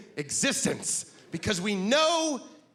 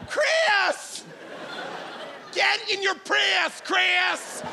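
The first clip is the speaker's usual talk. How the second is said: shouting guy; 13 semitones higher, much slower than usual. Retold or imitated. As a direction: holler at your friend to get in the car. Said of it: "This is my impression of a guy".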